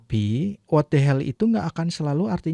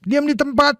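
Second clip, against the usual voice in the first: high, firm order to freeze; commanding call; 11 semitones higher, 3 dB louder than usual. Shown as a demonstration. Teacher demoing a police order to freeze. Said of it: "kita biasanya kita ngomongnya".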